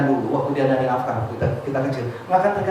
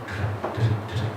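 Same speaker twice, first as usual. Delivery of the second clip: heartbeat sounds; 7 semitones lower, 8 dB quieter, slower than usual. Shown as a demonstration.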